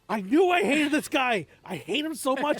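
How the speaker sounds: loud, high exasperated outburst; indignant reader blurting out hatred, half-laughing; outraged hate. Retold or imitated. Acting out outraged reader reaction.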